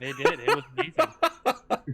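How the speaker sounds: laughing. Real, not acted. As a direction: squeal it through a laugh. Gushing laugh over fan art.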